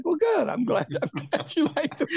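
high opening, tipping into laughter; amused retold reply. Host quoting his reply to Christine, amused. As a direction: laugh through the last words.

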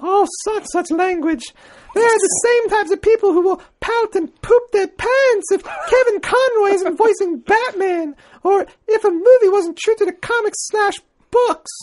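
high falsetto British lady reading aloud; hammed-up matronly falsetto; Mrs. Doubtfire. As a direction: read it out as an old lady, pitched sky-high. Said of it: "I like Doubtfire or Julia Childs".

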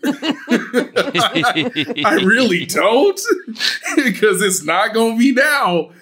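high, loud laughing exasperation; laughing disbelief. Real, not acted. Host laughing in disbelief that the quarterback won't retire.

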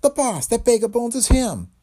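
high, loud horrified cry. Acted. Dame horrified at her dead boss's skeleton. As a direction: cry out in horror at the bones.

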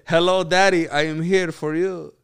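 loud, high put-on greeting, slowing at the end; put-on talking voice. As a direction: announce it in a showy foreign-guest voice. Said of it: "Why are you talking like that?".

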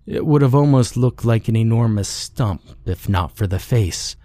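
ordinary delivery

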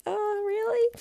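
high, startled "oh really"; mock-surprised reply to a compliment. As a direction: squeak it out in disbelief. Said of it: "I'm like".

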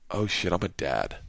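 low, soft aside to yourself; hushed realisation. Retold or imitated. Comic realising mid-trip he's a father, hushed. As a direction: drop the voice as it dawns.

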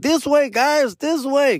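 high excited call to a crowd. Retold or imitated. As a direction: call the group over eagerly. Calling to crowd while running.

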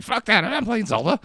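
put-on high, cheeky kid voice. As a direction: voice the bratty kids. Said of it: "They're like".

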